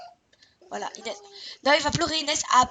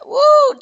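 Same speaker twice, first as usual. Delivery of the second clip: excited whoop; 12 semitones higher, 13 dB louder than usual. Real, not acted.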